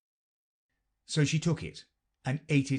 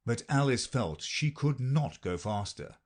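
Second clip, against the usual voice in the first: ordinary delivery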